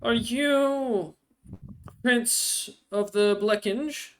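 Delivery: lightly voiced, slow question in primitive Knoll; halting primitive Knoll. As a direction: draw the question out as careful foreign speech. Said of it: "a perfect Knoll and, uh, primitive".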